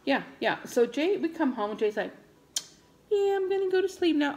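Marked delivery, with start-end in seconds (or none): 3.1-4.3 high, quick, casual daughter voice; high-pitched imitation of her daughter; imitated daughter. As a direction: toss it off quickly like a kid going to bed. Voices daughter Jay heading to bed.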